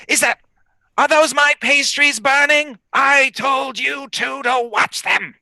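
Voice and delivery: high, scolding hag voice; scratchy hag voice. Acted. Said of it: "And she says".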